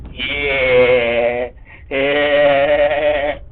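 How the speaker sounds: loud, long-held goofy yeah; drawn-out yeah. Pretend. Player joking about inserting the rod, drawn-out goofy yeah. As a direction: stretch the vowel out loudly.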